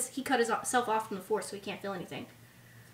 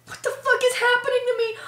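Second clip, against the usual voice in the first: much higher, louder panicked outburst; mock freak-out; 10 semitones higher, 5 dB louder than usual. Fan imagining Rey's reaction to her powers, mock freak-out. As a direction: act overwhelmed and bewildered, pitched way up.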